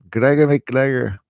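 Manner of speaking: mock Scottish burr, punched out; Scottish accent. Shown as a demonstration. Showing off Scottish after failed Irish, mock Scottish accent. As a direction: put on a quick Scottish accent. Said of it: "I can do the Scottish".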